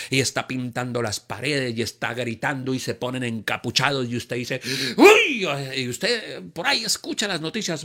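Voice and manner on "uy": mock exclamation. Reacting to riot news.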